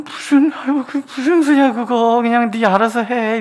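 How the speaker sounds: high, loud, exasperated mock grumble; mock-irritated high whine; weak whiner's voice. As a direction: play a petty complainer brushing it off. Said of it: "힘없는 사람은요".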